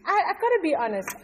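usual speaking voice

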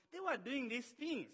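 ordinary talk

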